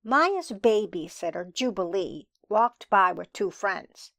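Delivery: plain reading voice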